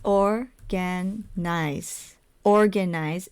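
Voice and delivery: "Organize" in three spaced beats, or, gan, ize; syllable by syllable. Shown as a demonstration. Teacher confirming the count to learners, "Organize" split syllable by syllable. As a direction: break "Organize" into three separate parts. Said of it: "If you said three".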